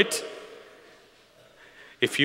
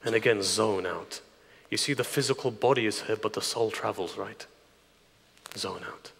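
guided meditation. Acted. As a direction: lead them gently into reflection. Guides listeners to zone out.

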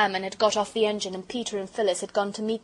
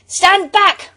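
loud, high warning shout; sudden cry; 11 semitones higher, 14 dB louder than usual. A narrator's reading. Peter pulling Phyllis from the train, sudden shout. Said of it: "cried Peter suddenly".

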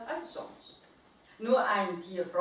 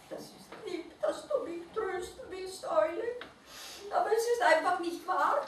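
high creature voice, whispered start, then quicker; sad little mole-rat; 9 semitones higher than usual. A narrator's reading. Mole-rat rejects the owl's comfort, high voice.

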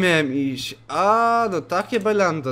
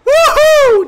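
much higher, much louder shriek; a startled shriek of fright; terrified scream; 16 dB louder than usual. Real, not acted. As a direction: scream at the jump scare. Jump scare.

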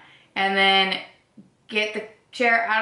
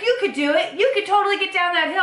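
higher, fast cheerleading; mock hype; 10 semitones higher, faster than usual. Urging friend down the steep Boston hill, mock-excited. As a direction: pump her up to roll down.

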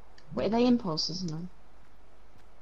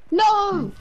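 panicked shout; 8 semitones higher, 4 dB louder than usual. Real, not acted. Enemy closing in on him.

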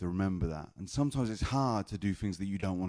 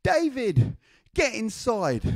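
very high-pitched calling grandma; slower than usual. Retold or imitated. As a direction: call the child in, in a high grandma voice. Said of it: "my grandma comes to me and she says".

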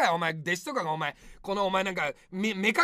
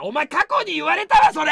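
loud, raised complaint; exasperated shout; 4 semitones higher, 8 dB louder than usual. Real, not acted. Streamer shouting that he's heard that joke before. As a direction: throw it back in disbelief.

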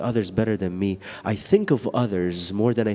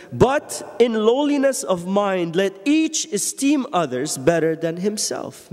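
high, slow, solemn reading of the verse; scripture reading; 8 semitones higher, slower than usual. Acted. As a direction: lift the verse up, slowly.

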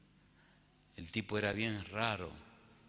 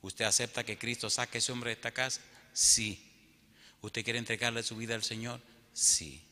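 normal talking voice

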